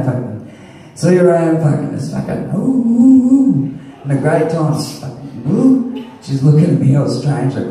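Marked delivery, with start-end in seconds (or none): 2.6-3.7 falsetto oohs, much higher. Retold or imitated. Miming the wristband swipe, squealing high oohs. 5.5-5.8 falsetto oohs, much higher. Retold or imitated. Miming the wristband swipe, squealing high oohs. Mimes swiping again.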